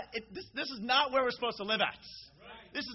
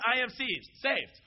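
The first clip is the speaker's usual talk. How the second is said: confident declaration; 4 semitones higher, 6 dB louder than usual. Shown as a demonstration.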